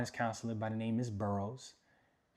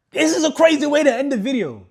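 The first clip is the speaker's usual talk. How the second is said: very high, very loud shout; full-out high-pitched holler; excited yell; 17 dB louder than usual. Real, not acted. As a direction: yell it out in amazement.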